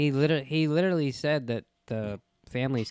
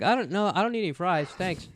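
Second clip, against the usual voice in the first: mock voice; 7 semitones higher than usual. Mocking a salt lover.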